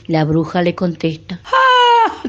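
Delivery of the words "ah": witch cackle. Acted. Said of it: "con una risa".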